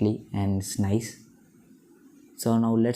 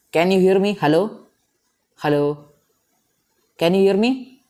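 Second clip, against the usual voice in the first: loud, higher checking calls; calling out; 8 semitones higher, 8 dB louder than usual. Real, not acted. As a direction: call out to test the line. Testing mute on call.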